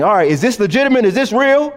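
quick, rising doubtful questions from the apostles checking a revival; questioning apostles. Retold or imitated. Apostles checking news of revival, asking quickly and doubtfully. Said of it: "they're always investigating".

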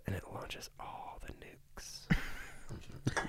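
very soft, slow whisper; hushed whisper. Acted. Storyteller reveals the big red button's effect, slow hushed whisper. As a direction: drop to a drawn-out whisper for the climax.

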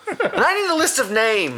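high put-on tough guy voice; mock gangster. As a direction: play the boss, half laughing. Role-play robber.

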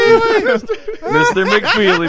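high, slow hammed-up call; mock children's show mailman; Mister McFeely. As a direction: stretch the name out in a high cartoon voice. Mimicking TV mailman.

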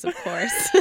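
reading through real laughter; amused, cracking up while reading aloud; laughing. Real, not acted. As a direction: break into a high laugh at the end. Cracks up reading his message.

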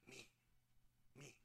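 put-on a whisper into a child's ear; retold whisper. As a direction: breathe the words secretly, as a charades clue. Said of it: "And I whispered in his ear".

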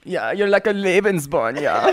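camp mock-German admirer; German accent. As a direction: compliment in a put-on German accent. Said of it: "You still gotta do the German".

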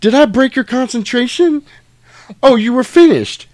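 high, loud mock-polite fury; loud and high, drip with fake courtesy; imitating Jules. Jules taunts Brett after the shot, loud, high and mock-polite. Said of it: "and he's like".

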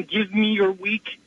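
usual speaking voice

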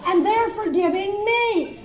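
hammed-up indignant cry; indignant outburst. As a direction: stretch each word in comic outrage. Comic outrage at insurer's forgiveness.

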